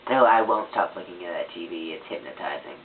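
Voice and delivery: normal talking voice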